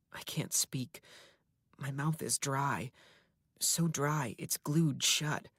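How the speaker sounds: ordinary delivery, nothing marked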